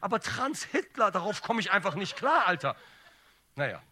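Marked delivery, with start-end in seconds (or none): 0.0-2.8 high, loud comic outburst; mock outrage. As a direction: exclaim it loudly in exasperated disbelief.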